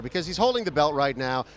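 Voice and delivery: normal talking voice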